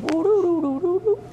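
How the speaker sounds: high, silly sing-song of a mind spinning; mock dizzy tune. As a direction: toss it off as a light loopy singsong. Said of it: "and you're thinking".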